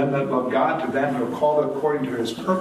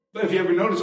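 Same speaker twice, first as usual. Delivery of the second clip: ordinary talk, nothing marked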